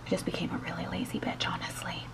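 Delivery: whispered confession; whispering. Real, not acted. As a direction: whisper it like a secret. Confessional aside.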